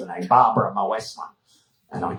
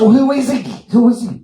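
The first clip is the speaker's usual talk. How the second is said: alarmed demand; 11 semitones higher, 6 dB louder, faster than usual. Retold or imitated. Demanding, high and loud.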